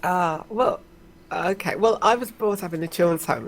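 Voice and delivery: ordinary talk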